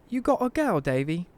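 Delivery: put-on high-pitched English old-lady voice; sweet English granny, pitched high. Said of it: "She's originally from England".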